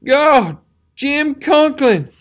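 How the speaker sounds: high, loud anguished scream; desperate shouted call. A narrator's reading. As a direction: scream his friend's name in horror. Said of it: "the youth screamed".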